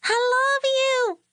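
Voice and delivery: put-on high, slow little girl calling out; a three-year-old hollering goodbye to her daddy; loudest toddler voice. A narrator's reading. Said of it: "hollered in her loudest three-year-old voice".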